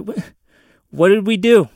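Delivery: incredulous mock protest; mock indignation. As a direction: play the wronged innocent, loud at the end. Mock outrage on behalf of Mexicans.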